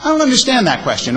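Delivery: high, fast reading of an emailed line; other reading of email. Shown as a demonstration. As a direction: raise the pitch and rush through it. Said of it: "And it could be".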